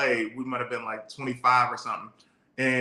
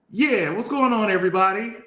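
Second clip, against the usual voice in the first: higher-pitched hometown voice, no country twang; Jordan; 8 semitones higher, 4 dB louder than usual. Retold or imitated. Man mimicking how his Detroit friend always sounded, higher-pitched impression. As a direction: imitate a friend's usual high, lively greeting. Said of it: "Jordan's voice was always like".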